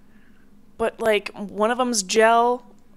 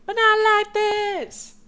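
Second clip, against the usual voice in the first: mock high voice; 13 semitones higher, slower than usual. Shown as a demonstration. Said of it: "Not like that, you guys".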